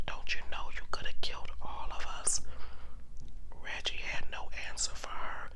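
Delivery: plain reading voice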